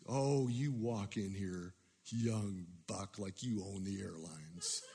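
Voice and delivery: lightly voiced belligerent drunk mocking a young man; hint of a drunk bully's jeer; gruff drunk man. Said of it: "gruff, loud voice".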